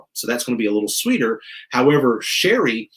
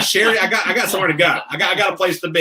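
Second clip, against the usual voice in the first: high-pitched playful pleading with a clerk; higher voice, hammed-up hurry; mock impatient customer; 7 semitones higher, faster than usual. Voices impatient DMV customer in banter.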